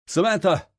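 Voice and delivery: high, loud call of her name; yell after her. A narrator's reading. Hawk calling after the fleeing woman, loud and high. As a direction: shout after her as she leaves. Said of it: "I yell after her retreating form".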